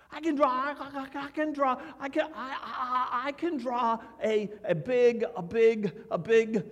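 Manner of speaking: put-on high, stammering kid bursting to answer; excited first grader. First graders all wanting to tell what they're drawing, excited stammer.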